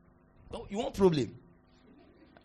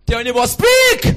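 shouted command; 3 semitones higher, 15 dB louder than usual. Acted. Preacher shouting at congregation to speak. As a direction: belt it out to the whole room. Preacher repeats, far louder.

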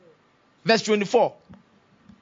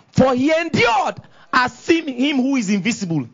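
raised, emphatic declamation; preacher's scripture reading; 5 semitones higher than usual. Real, not acted.

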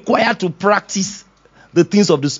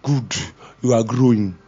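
gentle praise; 7 semitones lower than usual. Acted. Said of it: "the Holy Ghost telling me".

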